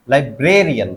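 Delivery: "Librarian" with ब्रे stressed; stress demo. Shown as a demonstration. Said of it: "क्या बोलेंगे?". ("Librarian" में ब्रे पर ज़ोर)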